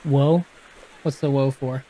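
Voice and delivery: ordinary delivery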